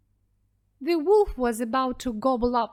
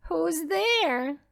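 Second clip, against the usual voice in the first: lightly high fake old lady; wolf in bed pretending to be grandmother; wolf faking granny; 7 semitones higher than usual. A narrator's reading. Wolf answering the knock in a mild granny voice.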